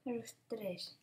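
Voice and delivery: usual speaking voice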